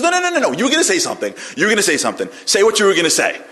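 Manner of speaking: high, loud, rapid-fire protest; insistent demand. Retold or imitated. Woman pressing the rabbi to finish, insistent demand. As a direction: press hard for the withheld answer.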